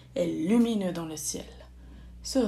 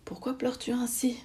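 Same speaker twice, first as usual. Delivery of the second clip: softer and higher, hushed concern; cautious, gentle question; 4 semitones higher, 5 dB quieter than usual. A narrator's reading. Approaching a crying cat, cautious gentle question. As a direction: ask gently, careful not to startle. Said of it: "s'approcha prudemment et demanda".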